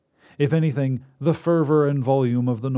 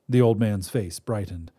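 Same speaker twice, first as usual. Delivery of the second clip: plain reading voice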